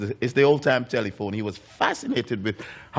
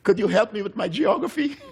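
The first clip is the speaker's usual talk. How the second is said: put-on child's question; 8 semitones higher than usual. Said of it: "and Paul would say".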